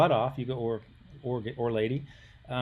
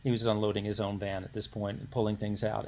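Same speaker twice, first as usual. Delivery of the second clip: ordinary talk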